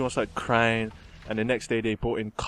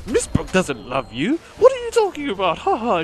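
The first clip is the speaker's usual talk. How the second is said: mocking teacher; 9 semitones higher, 5 dB louder than usual. Mean teacher mocks the boy.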